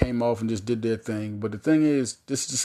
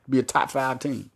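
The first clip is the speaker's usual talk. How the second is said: ordinary talk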